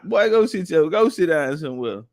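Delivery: ordinary talk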